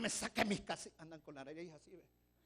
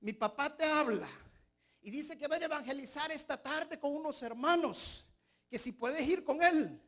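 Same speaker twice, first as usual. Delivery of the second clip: put-on a boy yelling for his mother, high and loud; child calling out; 9 semitones higher, 10 dB louder than usual. Pedrito shouts to his praying mother, high and loud. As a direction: call across the house like a kid. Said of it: "Pedrito le grita".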